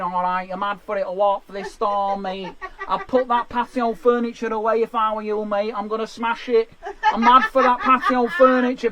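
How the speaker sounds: lightly voiced Manchester lad, cocky.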